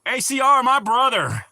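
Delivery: loud, high-pitched excited greeting; hearty shout-out. Real, not acted. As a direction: call out to a buddy who just showed up.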